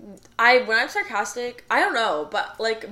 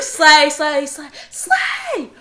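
shrieking slay, much higher and louder with a laugh; hammed-up slay squeal; 7 semitones higher, 11 dB louder, much slower than usual. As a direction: squeal each slay, camp and giddy.

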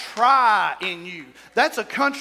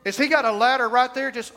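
ordinary delivery

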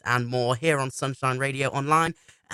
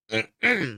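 throat clearing; 7 semitones higher than usual. Real, not acted. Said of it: "I'm choking on some sweets".

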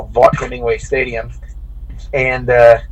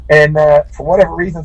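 ordinary talk

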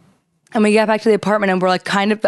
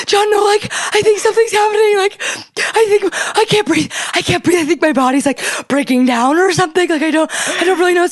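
high, breathless mock distress; faked panic; 9 semitones higher than usual. Wife pretending she can't breathe for John, high hammed-up panic. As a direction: overact the panic attack. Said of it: "I'm hyperventilating so hard".

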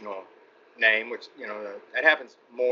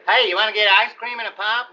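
put-on much higher, louder sweet-kid voice; wholesome kid actor; 11 semitones higher, 6 dB louder than usual. Mocking the cute roles he got cast in, sweet kid voice. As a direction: play the baby-faced boy next door. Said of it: "like somebody that should be saying".

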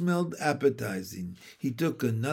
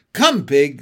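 loud, coaxing farm call; emphatic summons, voice raised; calling an animal; 8 dB louder than usual. A narrator's reading. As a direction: call the pig to the pail. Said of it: "said Mister Zuckerman".

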